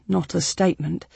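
plain reading voice

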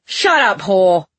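loud, contemptuous silencing; angry command. A narrator's reading. Nobleman silences the woman, angry and contemptuous.

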